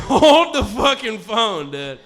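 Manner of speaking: loud, slow, laughing outburst; hammed-up shocked exclamation; mock outrage. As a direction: stretch it out in shocked disbelief. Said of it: "I was like".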